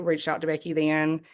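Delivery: normal talking voice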